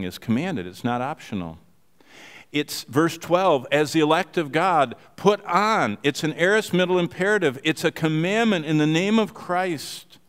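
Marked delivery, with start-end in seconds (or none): 2.5-10.2 preaching emphasis. Acted. Preaching the command.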